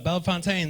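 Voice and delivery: Bellefontaine ending in a full "TAYN"; mispronounced town name. Retold or imitated. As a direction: say Bellefontaine as "bella-fon-TAYN". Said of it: "say the name of your city wrong".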